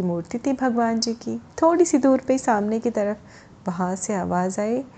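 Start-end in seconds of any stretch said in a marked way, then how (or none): none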